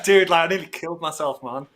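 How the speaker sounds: usual speaking voice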